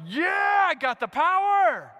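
mock-heroic whoop of victory; triumphant yell. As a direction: roar it like a new driver flooring it. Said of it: "where you're like".

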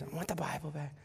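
soft imitation of a tearful plea; retold tearful plea. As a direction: plead softly as if in tears. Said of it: "I'm in tears".

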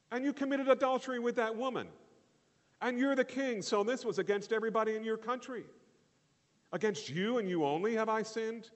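high, loud disbelieving outburst; incredulous protest. Acted. Listener protesting to David, high and loud in disbelief.

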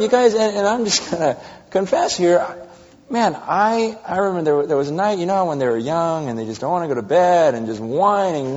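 usual speaking voice

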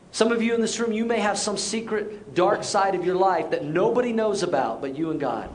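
normal talking voice

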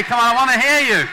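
high, very loud call to a crowd; rallying shout. Real, not acted. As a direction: shout to rouse the whole room. Shouting to rouse the congregation.

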